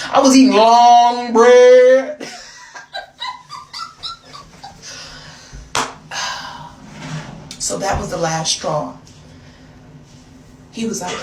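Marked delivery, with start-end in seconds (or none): 0.1-2.3 loud, slow, drawn-out punchline; big emphatic comic exaggeration; comic boast. Real, not acted. Boasting punchline about turning French. 2.3-7.6 long, high wheezing laugh; helpless trailing giggle. Real, not acted. Laughs at own punchline.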